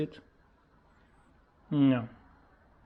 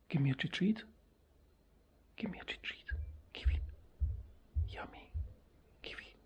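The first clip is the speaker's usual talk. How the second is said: hushed, unvoiced whisper; whispering to pet; 3 semitones higher, 8 dB quieter, much faster than usual. Shown as a demonstration. Owner coaxing parrot with a treat, very soft whisper. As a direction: whisper it softly to coax a bird.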